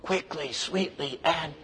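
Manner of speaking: slow, hushed whisper; very soft, secretive whisper. Shown as a demonstration. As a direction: whisper it to the person beside you. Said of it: "Turn to the person next to you and whisper it".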